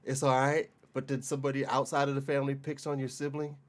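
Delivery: normal talking voice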